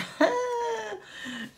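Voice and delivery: giggling squeal; high squealing laugh. Real, not acted. Laughs at the shade name.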